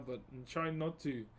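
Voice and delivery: normal talking voice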